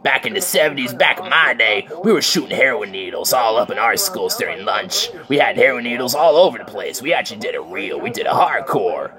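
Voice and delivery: put-on old-timer rant. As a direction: sneer at the kids like a grizzled elder. Old-timer rant about the seventies.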